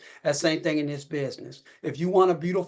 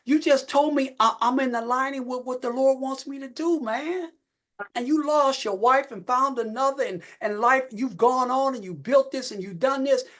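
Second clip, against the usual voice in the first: excited retold call; 8 semitones higher than usual. Retelling his excited call to Eddie.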